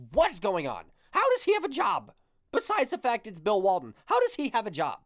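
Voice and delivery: high-pitched exasperated disbelief; incredulous outburst. Retold or imitated. Ranting in disbelief at Walton.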